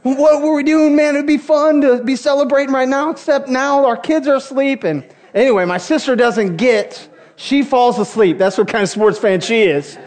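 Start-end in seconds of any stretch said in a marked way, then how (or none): 0.0-4.8 higher, giddy chatter; like little kids. Retold or imitated. Two fans on the phone after the win, giddy and higher. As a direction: babble like an overexcited kid. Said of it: "We're on the phone like little kids".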